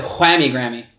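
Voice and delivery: WHAMMY with a breathy H before the W; audible "hw" opening on whammy; soft H. Shown as a demonstration. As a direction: say "whammy" as hwammy. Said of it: "it's a soft H though".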